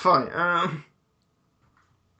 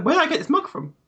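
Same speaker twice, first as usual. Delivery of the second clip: mock puzzled character; 13 semitones higher, 5 dB louder than usual.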